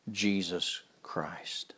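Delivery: slow reverent whisper. Acted. Preacher giving thanks to God, whispering slowly. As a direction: let the name fall to a hushed whisper.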